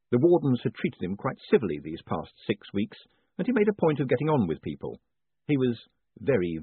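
usual speaking voice

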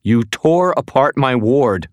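high, loud, slow accusation; accusing. Acted. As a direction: throw the charge at him. Woman accuses the intruder.

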